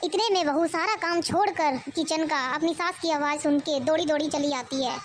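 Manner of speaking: usual speaking voice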